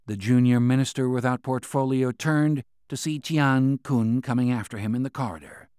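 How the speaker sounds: ordinary delivery, nothing marked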